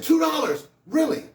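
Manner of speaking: put-on high, loud boyish shout; excited shout. Son shouting the price to his father, thrilled. Boy shouts the offer to dad.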